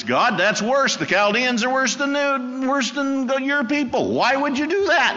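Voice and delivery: high, loud, indignant complaint; upset prophet. Retold or imitated. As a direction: protest to God as the outraged Habakkuk. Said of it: "Habakkuk says".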